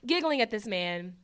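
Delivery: ordinary talk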